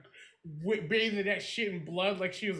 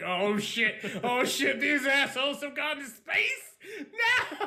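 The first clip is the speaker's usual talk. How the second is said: laughing mock panic; 7 semitones higher, 4 dB louder, slower than usual. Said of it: "I was like".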